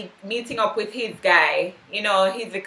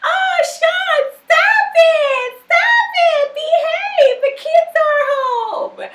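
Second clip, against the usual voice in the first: very high squealing voice, giggling protests; giggly flirty woman; 4 dB louder, much slower than usual. Retold or imitated. Tasha protesting at Sean's kisses, high giggly squeal. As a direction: squeal it like a flirty woman pushing him off playfully. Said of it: "And she's like".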